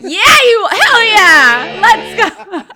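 loud, high laughing hell yeah; excited cheer. Retold or imitated. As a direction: whoop it like a fired-up fan. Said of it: "and you're just like".

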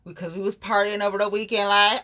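normal talking voice

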